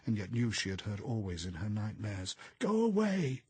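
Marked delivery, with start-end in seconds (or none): 2.6-3.4 high, loud shrieking cry; wild nightmare scream. A narrator's reading. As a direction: shriek it to drive them off. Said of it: "it screamed".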